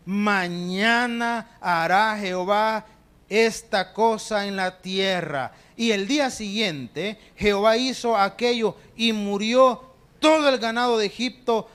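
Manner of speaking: slow, loud, solemn Bible reading; scripture reading. Acted. Preacher reading Exodus aloud to the church: slow and loud. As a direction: proclaim the verse slowly to the congregation.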